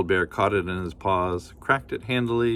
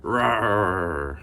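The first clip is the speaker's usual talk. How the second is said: bear growl. Acted. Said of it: "Old Bear growled".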